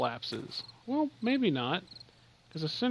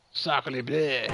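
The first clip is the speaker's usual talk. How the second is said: mock French accent; 7 dB louder than usual. Then asks if French word.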